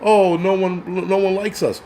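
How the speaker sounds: hammed-up whimper; mock woe. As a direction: play the woe-is-me card, stretched out. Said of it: "woe is me card".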